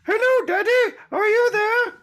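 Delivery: put-on high squeaky child calling out; little kid voice. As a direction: play a tiny kid calling for daddy. Kid calling father, comic skit.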